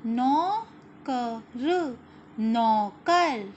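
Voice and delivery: मोर loud, the second slowly drawn out; read-along word. Shown as a demonstration. Teacher reading words aloud for children to repeat, slow and loud. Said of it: "दूसरा शब्द है हमारा".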